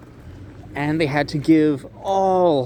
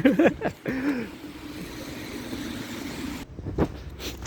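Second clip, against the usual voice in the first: breathy laugh; 13 semitones higher, 6 dB quieter than usual. Real, not acted. Laughing at frozen hands.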